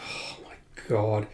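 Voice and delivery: hushed, breathless gasp of words; shocked whisper at the bloodshed; stunned whisper. Acted. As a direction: whisper it in horror. Stunned onlooker gasps.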